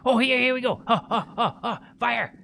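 loud and high, gleeful; excited. Real, not acted. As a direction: cheer it on loudly. Excited attack in game.

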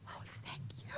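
hushed, voiceless prayer of thanks; barely audible grateful whisper; relieved whisper. Retold or imitated. Hiding behind friend, secretly relieved.

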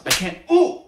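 high pained yelp; just got slapped. Acted. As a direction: cut off by a slap. Slapped mid-sentence.

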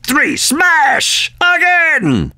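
triumphant yelled smash; shouted smash. Acted. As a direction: bellow it out as you strike.

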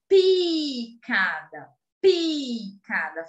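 "Picada" said very slowly, with a clear, voiceless P at the start; slow model word. Shown as a demonstration. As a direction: sound out "picada" syllable by syllable, stressing the P. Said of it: "Falem aí na sua casa". ("picada" pronunciado bem devagar, marcando o P)

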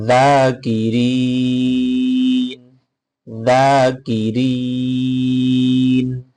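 জাকিরিন chanted slowly with a long final; drawn-out tajweed; slow Quran recitation. Shown as a demonstration. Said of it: "তেলাওয়াত".

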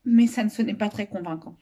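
ordinary delivery

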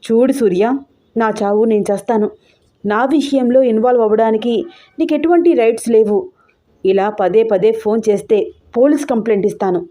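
usual speaking voice

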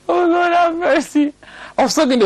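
helpless with laughter, stretch the words out; laughing. Real, not acted. Preacher overcome by his own story, laughing. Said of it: "It's already making me laugh".

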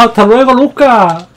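high, loud mocking farewell; gleeful shout. Real, not acted. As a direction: yell the goodbye in triumph. Knocks rival off the stage. (grito burlón de despedida)

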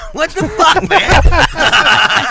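high, loud, cracking up; laughing protest. Real, not acted. Storyteller interrupted by a crude joke, laughing protest. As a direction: protest helplessly through real laughter.

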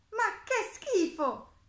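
high, drawn-out cry of disgust; loud, slow and high, thoroughly revolted; disgusted exclamation. A narrator's reading. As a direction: exclaim it like a grossed-out little mole. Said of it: "esclamò la piccola talpa".

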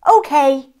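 cheerful okay. Acted. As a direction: pop it out happily. Dinosaur agrees to sleepover.

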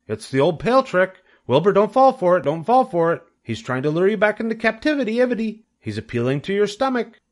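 high, loud goose voice; alarmed goose. A narrator's reading. The goose warns Wilbur off the pail, urgent.

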